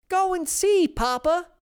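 put-on very high, loud old lady; old woman voice. A narrator's reading. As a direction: call out to Papa.

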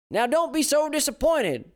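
very high, loud soothing granny; old woman voice. A narrator's reading. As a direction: coax like a Southern grandma.

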